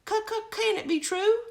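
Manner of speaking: very high crowing voice; crowing bird. Acted. Crow reacting to the news, high crowing voice. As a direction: crow it like a rooster. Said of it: "crowed the crow".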